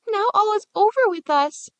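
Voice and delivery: put-on a little girl crying it out; tearful despair. Gretel weeping to Hansel, tearful and high. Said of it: "Gretel wept bitter tears".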